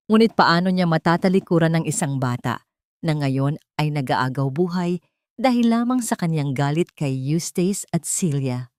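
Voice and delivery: ordinary delivery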